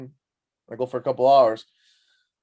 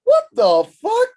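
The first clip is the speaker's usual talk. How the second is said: much higher, louder, drawn-out slow shriek; shocked shriek; 8 dB louder, much slower than usual. Retold or imitated. Light moving with him in the dark car, shrieking in shock. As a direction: stretch it into a panicked squeal. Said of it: "I'm like".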